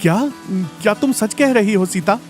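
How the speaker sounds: hesitant, disbelieving question; touched, halting surprise; stunned husband. Acted. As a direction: ask as if unable to believe it. Husband stunned by wife's loving words.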